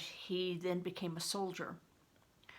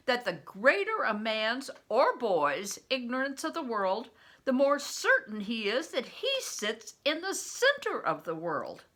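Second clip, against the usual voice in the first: laughing scorn; 4 semitones higher, 6 dB louder than usual. Acted. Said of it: "with laughing scorn".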